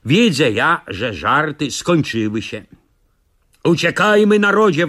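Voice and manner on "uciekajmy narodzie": loud, high call to flee. Acted. Narrator calls villagers to flee, loud high call. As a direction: rouse the village to run. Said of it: "wołam".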